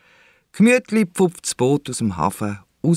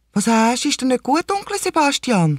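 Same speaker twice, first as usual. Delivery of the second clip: put-on high, caring child's question; concerned little girl; 13 semitones higher than usual. A narrator's reading. Lisa asking her seasick uncle, high and concerned. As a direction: ask like a small niece checking on her uncle. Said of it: "fragt Lisa".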